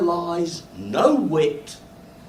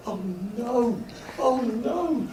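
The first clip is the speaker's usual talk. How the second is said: embarrassed; 7 semitones higher, slower than usual. Retold or imitated. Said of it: "Bright red".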